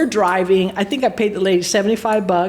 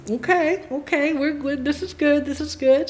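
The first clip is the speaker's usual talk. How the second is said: higher, lightly voiced fortune-teller; murmuring psychic; 4 semitones higher, 4 dB quieter than usual. Psychic talking over the coffee reading, lightly imitated.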